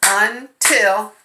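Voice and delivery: Until tapped as un-til; teacher tapping Until for the class; syllable tapping. Shown as a demonstration. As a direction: tap out Until. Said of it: "Let's tap it out".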